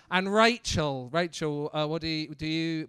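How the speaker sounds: usual speaking voice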